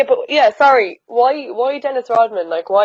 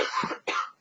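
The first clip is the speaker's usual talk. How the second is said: dry cough; 15 dB quieter than usual. Real, not acted. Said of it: "Sorry, I have a cough".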